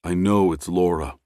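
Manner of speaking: ordinary delivery, nothing marked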